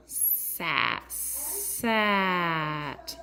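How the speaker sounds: SAT drawn out, sound by sound; slow sounding-out. Shown as a demonstration. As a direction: hold the S and A in SAT, short T. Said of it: "they do it slow".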